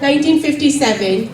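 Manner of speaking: usual speaking voice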